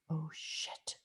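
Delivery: breathless whispered oh shit; whispered curse. Retold or imitated. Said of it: "I excuse myself here".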